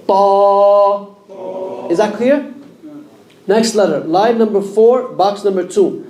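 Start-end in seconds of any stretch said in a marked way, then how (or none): 0.1-1.1 Ta. heavy, with a hollow, backed vowel; correct emphatic ta. Shown as a demonstration. Teacher giving the correct heavy letter after the mistake. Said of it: "move away from the ta".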